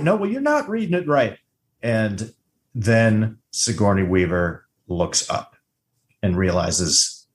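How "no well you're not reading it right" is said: high, insistent mock contradiction; acted dispute. Co-host re-enacting the tracker argument, insistent. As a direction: contradict in a raised pitch.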